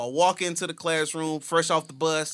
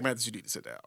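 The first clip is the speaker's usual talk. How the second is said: quiet, lower stern teacher; teacher's stern order; 5 semitones lower, 10 dB quieter, much faster than usual. Retold or imitated. Teacher quietly telling Mathis to sit, stern. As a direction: tell him firmly to sit down. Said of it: "She was like".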